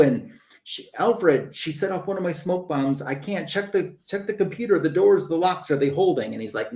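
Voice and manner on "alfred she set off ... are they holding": higher, urgent questions to Alfred; alarmed Batman. Retold or imitated. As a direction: rattle off the checks in a hurry.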